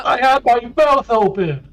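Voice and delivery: loud, high, drawn-out complaint; hammed-up yell of disgust; mock horrified wail. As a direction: wail it slowly in comic dismay. Splattered player wails in mock horror.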